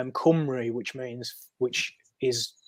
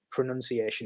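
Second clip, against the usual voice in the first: ordinary delivery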